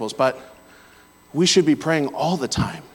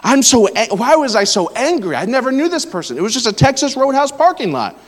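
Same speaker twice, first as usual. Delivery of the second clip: worked-up anger; 8 semitones higher, 4 dB louder than usual. Real, not acted. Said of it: "I'm angry".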